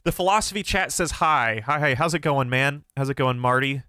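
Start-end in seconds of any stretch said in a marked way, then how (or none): none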